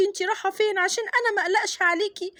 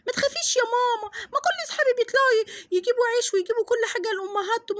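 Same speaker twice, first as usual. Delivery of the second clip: put-on pleading little girl; 3 semitones higher than usual. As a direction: beg mum not to worry. Daughter pleads to go out. (طفلة بتطمن مامتها بإلحاح)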